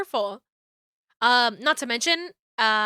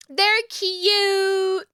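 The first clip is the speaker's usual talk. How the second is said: cooing; 8 semitones higher, 3 dB louder than usual. Acted. Cooing over twins.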